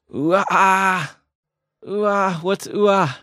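high, drawn-out exclamation of amazement; emotional wow. Shown as a demonstration. Host introduces a new interjection, emotional drawn-out wow. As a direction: gush the interjection slowly, pitched well up. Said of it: "I just felt emotional right now just saying that".

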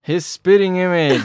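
mock exclamation. Joking delivery, partner laughs after.

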